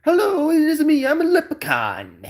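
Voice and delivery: high, loud hammed-up Irish leprechaun accent, breaking into a laugh; mock Irish accent. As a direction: play a cheeky Irish leprechaun with a put-on Irish lilt. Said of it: "do the good old Irish accent".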